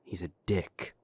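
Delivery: usual speaking voice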